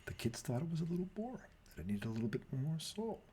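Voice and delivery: teacher answering the principal, softly and timidly. Retold or imitated.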